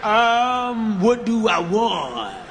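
slow, halting order with ums; nervous hesitant order. Retold or imitated. A nervous first-timer ordering from the barista, slow and halting. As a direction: stall nervously, unsure what to say. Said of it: "I'm trying to fit in".

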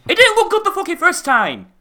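very high, squawking exasperation; loud incredulous rant at a high pitch; pissed off. Real, not acted. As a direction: let frustration send the voice way up. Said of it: "this is what pisses me off".

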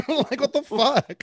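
high laughing disbelief; cracking up in disbelief. Real, not acted. Laughing at a fan fight.

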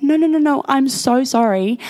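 high, insistent retold apology; acted-out flustered apologising; profuse apology.

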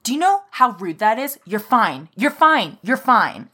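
imitating a rude customer getting in her face; dramatic mock scolding. Retelling how a stranger scolded her at the counter, loud mock indignation. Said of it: "And very dramatically, while moving her head back and forth".

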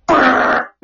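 unvoiced lip-buzz fart noise. Retelling a fart on the couch, lip-buzz fart sound. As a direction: blow a raspberry for the fart. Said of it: "it was like".

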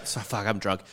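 ordinary talk, nothing marked

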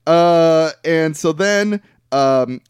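ordinary talk, nothing marked

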